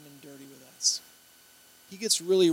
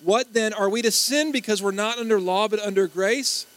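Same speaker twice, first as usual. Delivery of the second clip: loud, higher-pitched group recital; wholehearted recitation; 5 semitones higher, 7 dB louder than usual. Shown as a demonstration. Preacher leading the congregation in a verse, wholeheartedly. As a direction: lead the room in a full-voiced question.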